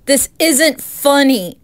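loud, slow, frightened outcry; panicked loud demand; scared shouting. Acted. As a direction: yell at him through the fear. Frightened at someone in garden.

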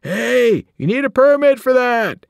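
comic police holler; policeman shouting. A narrator's reading. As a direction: shout after the runaway zebra.

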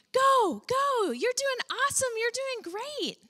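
high, loud cheerleader encouragement; mock cheering. A cheerleader urging someone in the pit, high and loud. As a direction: cheer them on like a coach from the sideline.

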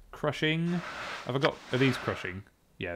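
usual speaking voice

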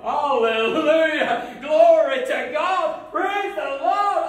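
high, loud and slow, shouting hallelujah; joyful shout. Retold or imitated. The team praising God over the healed girl, a slow, loud, high joyful shout. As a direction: cry out praise like a revival crowd. Celebrating the girl's healing.

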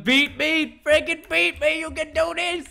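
high, drawn-out pleading cheer; impassioned encouragement. Real, not acted. Urging rival to beat him.

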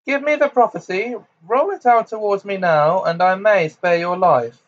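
high, loud commanding villainess; imperious demand. A narrator's reading. Bellatrix demands the prophecy from Potter, commanding. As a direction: start very high, order him loudly.

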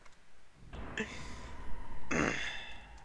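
airy high-pitched giggle; high breathy laugh. Real, not acted. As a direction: silent wheezing laugh. Reacts to game message.

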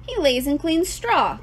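correcting. A narrator's reading. Husband correcting his wife about the pig, correcting. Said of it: "corrected Mister Zuckerman".

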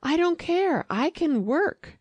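very high, determined outburst; defiant protest. A narrator's reading. Wife offers to work for her husband, high and defiant. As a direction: leap up high and insist.

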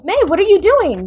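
ordinary delivery

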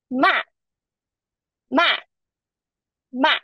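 MA starting high and dropping sharply; falling fourth tone. Shown as a demonstration. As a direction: say MA with a quick firm fall. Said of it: "is a falling tone".

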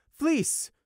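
sharp, very loud high yell; shout. Acted. Mother yelling at frozen son: very loud. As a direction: yell his name over the noise.

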